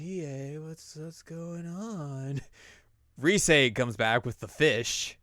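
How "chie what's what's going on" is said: slow, soft, higher sleepy mumble; sleepy Yukiko. Acted. Yukiko just woken, asking Chie what's going on: drowsy slow mumble.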